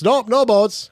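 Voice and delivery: high, loud mock clerk turning someone away; curt store clerk. Clerk telling a shopper there are no boats, high loud mock refusal.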